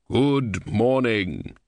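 grave and measured, like Death. A narrator's reading. Death speaks.

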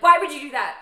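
loud, high exasperated demand; retold outrage, voice jumping up; mad outburst. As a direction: burst out in indignant disbelief. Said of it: "because I was so mad".

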